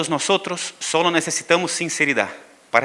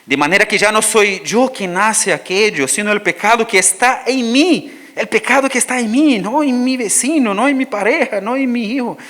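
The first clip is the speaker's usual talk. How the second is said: higher, louder preaching build; preaching fervor; 8 semitones higher, 5 dB louder than usual. Acted. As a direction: drive the point home from the pulpit. Preaching at full force. (predicación ferviente y en alto)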